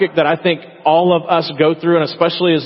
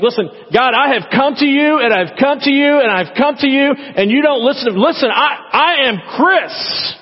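whiny demanding prayer; 9 semitones higher than usual. Retold or imitated.

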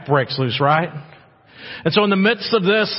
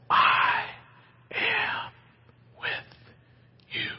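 whispered promise; 8 dB quieter, much slower than usual. Acted. Father's voice in hard times.

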